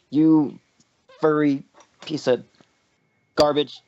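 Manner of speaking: mock scream. Player mocks a giant rat in battle, hammed-up yelling. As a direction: hurl it at the rat with big pauses. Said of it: "I'll scream at this rat".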